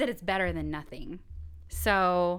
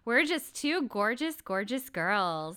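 sassy slogan; 3 semitones higher, 8 dB louder, slower than usual. Acted. Show slogan, stretched out.

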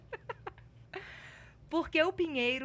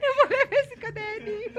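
louder, higher, laughing; 9 semitones higher, 8 dB louder than usual. Real, not acted. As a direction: say it through a laugh. Laughing after joke.

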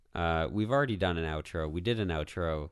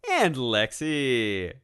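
drawn-out; 4 semitones higher, 5 dB louder, much slower than usual. Pretend. Outro take again.

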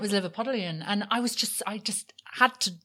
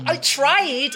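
loud, higher jokey Scottish accent; mock Scottish accent; 7 semitones higher, 7 dB louder than usual. Shown as a demonstration. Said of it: "I sounded like that in my head".

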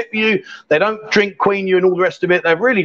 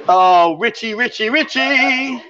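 drawn-out call, rising higher; 4 semitones higher, much slower than usual. Real, not acted. Host calling for his absent helper, drawn-out. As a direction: stretch the last name out in a singsong call.

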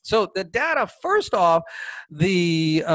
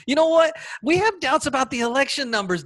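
emphatic retort; 8 semitones higher than usual. Real, not acted. Retort to John King.

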